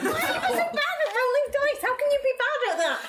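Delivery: high, squeaky complaining voice; high raccoon voice. Acted. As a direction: whine it like a little raccoon. Player in high character voice.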